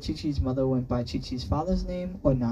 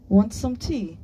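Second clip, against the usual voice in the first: higher, hurried offer; asked quickly; 7 semitones higher, 3 dB louder, slower than usual. A narrator's reading. Chi-Chi offering tea to cut off questions, asking quickly. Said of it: "Chi-Chi asked quickly".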